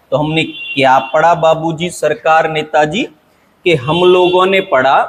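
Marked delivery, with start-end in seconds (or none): none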